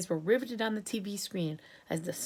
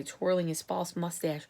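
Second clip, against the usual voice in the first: usual speaking voice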